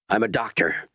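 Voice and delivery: ordinary delivery, nothing marked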